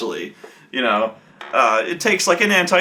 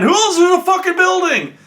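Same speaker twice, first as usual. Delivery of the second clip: much higher, louder interrogation yell; angry yell; 4 dB louder than usual. Retold or imitated. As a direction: bark it at the prisoner. Interrogating the prisoner, yelling.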